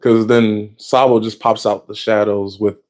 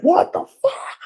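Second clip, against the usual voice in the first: high, strained cry of outrage; mock crash-out scream; slower than usual. Emu losing it in the throne room, high furious screech. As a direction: screech it like you've lost it. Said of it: "Emu crashes out".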